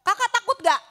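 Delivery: ordinary talk, nothing marked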